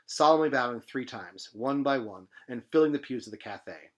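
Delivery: usual speaking voice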